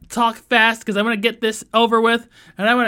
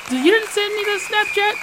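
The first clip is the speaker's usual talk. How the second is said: fast, higher asking tone; higher quick questioning voice; 10 semitones higher, faster than usual. Retold or imitated. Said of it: "It's like".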